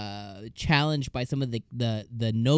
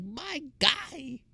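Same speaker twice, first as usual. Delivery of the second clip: much higher, squeaky "my guy, my dude"; squealing disbelief; much slower than usual. Retold or imitated. Reacting to Cain flirting in front of the king, squealing disbelief. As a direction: gasp it out as if you can't believe his nerve. Her reaction to Cain's boldness.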